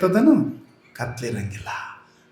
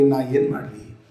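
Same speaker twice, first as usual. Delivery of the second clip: helpless fade; 3 semitones lower, 5 dB quieter than usual. Acted. Wife's repeated despairing cry.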